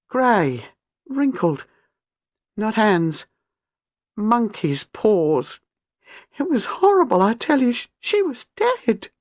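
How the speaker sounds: high, slow, shaken telling; young wife recounting a vision of murder, high and shaken; horrified recollection. A narrator's reading. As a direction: relive the horror, voice climbing. Horror recalled, high pitch.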